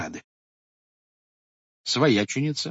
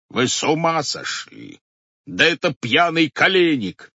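angry; 7 semitones higher, 5 dB louder than usual. A narrator's reading. Head sees wrong captive.

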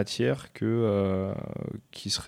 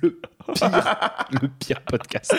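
laughing; 4 semitones higher, much slower than usual. Real, not acted. Joking about a modem podcast.

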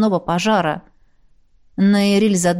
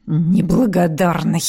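offended; 2 semitones lower, slower than usual. A narrator's reading. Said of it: "Обидевшись".

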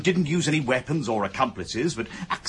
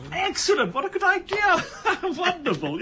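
much higher, bubbling with laughter; gushing delight. Acted. Inspector enthusing over Mrs Groynes's plan, much higher and laughing. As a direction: gush over the idea, laughing.

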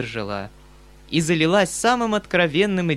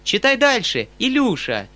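much higher, louder urging shout; excited cry; 9 semitones higher, 5 dB louder than usual. A narrator's reading. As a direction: urge him on loudly. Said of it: "вскричала Сашенька". (громкий возглас)

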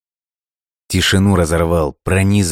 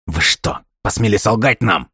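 angry; 6 semitones higher than usual. A narrator's reading. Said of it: "В ярости воскликнул".

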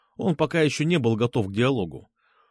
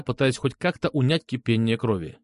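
ordinary delivery

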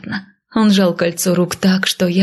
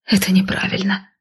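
timid, whispered protest; faltering murmur. A narrator's reading. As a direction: murmur it shyly, half-whispered. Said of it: "пролепетала я". (робко, почти шёпотом)

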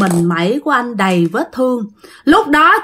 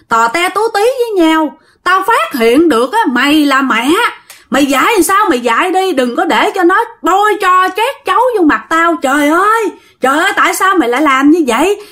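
high, indignant acted scolding; accusing, raised-pitch rant; father cursing; 6 semitones higher than usual. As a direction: lay into the mother like an irate husband. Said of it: "ba mới chửi".

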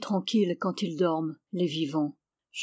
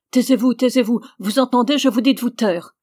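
loud, higher furious shout; angry shouting; 4 semitones higher, 7 dB louder than usual. A narrator's reading. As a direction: drown him out in rage. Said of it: "la colère de monsieur David".

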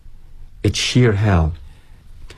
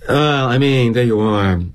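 ordinary delivery, nothing marked

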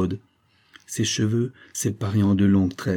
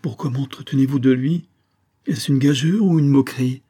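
higher-pitched, upset questioning; wounded, raised voice; tearful outcry; 6 semitones higher, 4 dB louder than usual. A narrator's reading. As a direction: plead as if near tears. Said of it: "avec un sanglot dans la voix".

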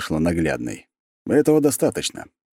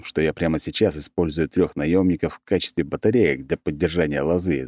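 plain reading voice

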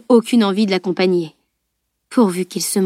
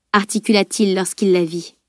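ordinary delivery, nothing marked

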